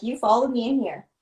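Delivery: ordinary talk, nothing marked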